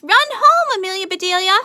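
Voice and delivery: very high, loud urgent shout. A narrator's reading. Boys shouting at Amelia Bedelia to run home, high and loud. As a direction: call her home at full voice. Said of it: "shouted the boys".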